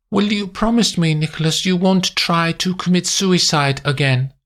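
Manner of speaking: high, composed clinical woman's voice; cool German woman Erica. A narrator's reading. Erica lecturing Nick on the job, cool and clinical. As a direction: speak it calmly, coolly detached.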